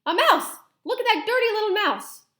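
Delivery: high, loud cry of alarm; man yelling, high and loud. Acted. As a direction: yell it to alert the whole kitchen. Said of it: "I heard a man's voice yelling".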